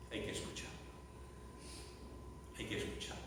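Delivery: whispered, very soft; hushed urging. Real, not acted. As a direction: drop to a whisper to make them listen. Preacher hushes to urge listening. (susurro, en voz muy baja)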